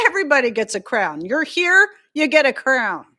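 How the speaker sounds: quick, loud, high proclamation; excited fast cheer. Real, not acted. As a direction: rattle it off with glee. Cheering viewers.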